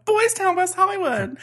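high, loud sing-song mockery; mock-snooty. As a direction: sneer the name in a high prissy voice. Mocking rival town claim.

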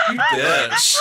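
very high, laughing squeal; high-pitched laughing insult; mock outrage. Real, not acted. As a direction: squeal it through helpless laughter. Mock outrage at being teased, laughing.

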